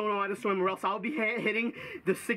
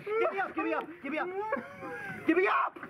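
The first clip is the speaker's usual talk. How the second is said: higher, frantic pleas to be lifted; desperate calls for help; 7 semitones higher, faster than usual. Real, not acted. Panicked swimmer begs friends to pull him up, desperate. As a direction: yell for rescue. Begging to be pulled up.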